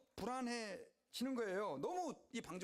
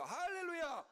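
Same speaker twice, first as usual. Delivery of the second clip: preacher shouting hallelujah to the church; shouted hallelujah; 10 semitones higher, slower than usual. Real, not acted. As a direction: call it out to the congregation.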